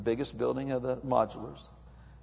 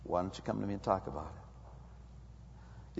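much softer, lower, tender call; gentle invitation; 6 semitones lower, 15 dB quieter than usual. Acted. God gently inviting the confused believer to talk. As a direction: invite them softly as God would. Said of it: "God's going".

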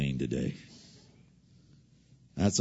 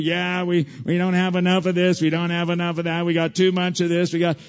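lightly voiced complaining man on the street; a hint of a whiny interviewee; 10 semitones higher, 7 dB louder than usual. Preacher voicing a complaining street interviewee, lightly whiny. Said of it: "do interviews on the street".

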